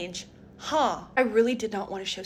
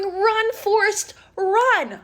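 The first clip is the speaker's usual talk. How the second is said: high, louder taunting chant; a high, louder jeering call; mocking taunt; 9 semitones higher, 5 dB louder, much slower than usual. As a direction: taunt her as she runs. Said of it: "Karina's going".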